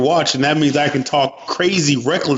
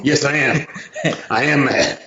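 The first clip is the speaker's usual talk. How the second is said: laughing retort. Retold or imitated. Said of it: "I said".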